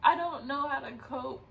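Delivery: cope by laughing while close to tears; real laughter breaking into tears; laughing on the edge of tears. Real, not acted. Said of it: "I want to cry so bad".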